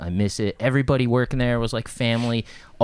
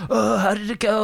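high, sing-song mock question; mocking colleagues; 11 semitones higher, 3 dB louder than usual. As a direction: tease him with fake sweetness. Colleagues mock after failed interview.

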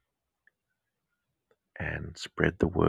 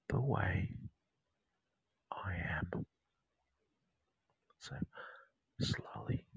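whispered meditation; 3 dB louder than usual. Acted. Meditation guide whispering mantras to listeners, hushed and intimate. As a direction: whisper the mantras right into their ear. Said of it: "sound like I'm whispering in your ear".

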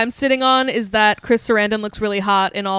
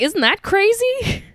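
much higher, drawn-out mock question; blithe wonderment; 10 semitones higher, slower than usual. Imitating the unconcerned woman remarking on her symptoms, blithe wonderment. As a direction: go high and slow, imitating her breezy reaction.

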